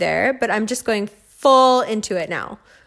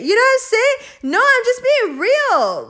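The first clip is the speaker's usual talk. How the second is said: high emphatic insistence; 12 semitones higher, 4 dB louder than usual. Real, not acted.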